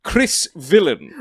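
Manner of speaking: announcer voice. Acted. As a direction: boom out the name like a ring announcer. Grand guest introduction.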